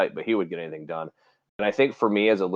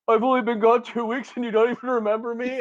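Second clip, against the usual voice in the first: high, wounded mock whine; hurt whiny caller; 13 semitones higher than usual. Adzema on the phone, hurt he was forgotten, high mock whine. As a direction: play the offended ex-friend, whining high.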